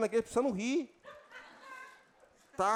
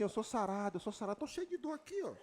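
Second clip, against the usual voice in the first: softer, half-laughing mock claim; mock the faithless believer; mock hollow claim; 11 dB quieter, faster than usual. Preacher mocking a church's empty healing claims. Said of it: "cita assim".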